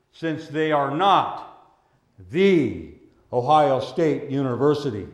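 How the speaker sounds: ordinary delivery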